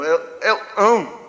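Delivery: "ão" with its first consonant missing; dropped consonant. Shown as a demonstration. Imitating the great-aunt who couldn't say cão, saying only "ão". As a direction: stammer into "ão", leaving off the opening sound. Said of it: "Ela não falava cão".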